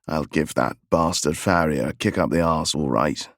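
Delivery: low English gent grumbling about the farrier; English accent. A narrator's reading. Horseman grumbles about the farrier in a low English accent. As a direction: growl it low with English vowels. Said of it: "He pronounced the word arse".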